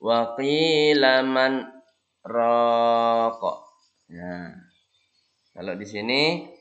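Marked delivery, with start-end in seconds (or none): 0.0-3.5 brief pause after "man" before "raqa", slow; saktah. Shown as a demonstration. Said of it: "Kemudian ini saktah".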